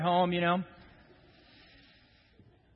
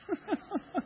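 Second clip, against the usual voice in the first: high laugh; 7 dB quieter than usual. Real, not acted. Laughs at own joke.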